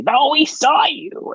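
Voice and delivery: high, slow goblin voice; goblin guards. Acted. Goblin guards contradicting Yemek, high goblin insistence. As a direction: squeak it up, insistent. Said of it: "And they say".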